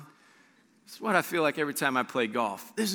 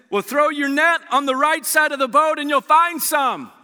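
calling out; 11 semitones higher, 7 dB louder than usual. Acted. Voicing Jesus calling from shore.

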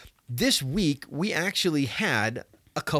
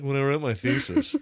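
mock dramatic imitation. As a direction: echo the words in a put-on solemn tone. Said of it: "when you say, like".

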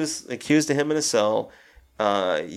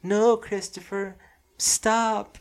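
put-on much higher, slow child's plea; little sister's voice; 10 semitones higher, much slower than usual. Retelling the dead sister's voice in the film, high child plea. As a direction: pitch way up like a frightened little girl. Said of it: "his dead little sister's voice".

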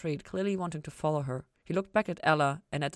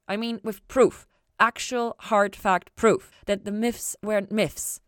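louder, slow, each word pressed; insistent; 6 semitones higher, 8 dB louder, slower than usual. A narrator's reading. Chloe pressing Ella for proof, slow loud emphasis. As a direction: hammer home the proof.

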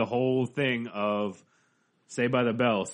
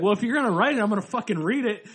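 higher, louder, fed up; loud exasperated; 9 semitones higher, 5 dB louder, faster than usual. Retold or imitated. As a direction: blurt it out, exasperated. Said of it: "he's like".

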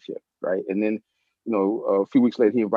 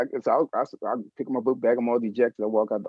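ordinary talk

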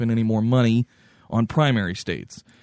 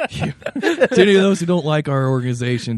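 higher, through laughter; laughing; 6 semitones higher, 4 dB louder than usual. Real, not acted. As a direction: try to finish the sentence while laughing. Speaker restarts while cracking up.

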